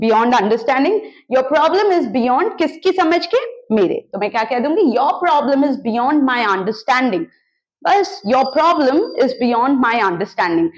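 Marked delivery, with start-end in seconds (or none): none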